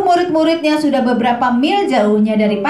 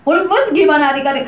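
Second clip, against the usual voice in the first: ordinary talk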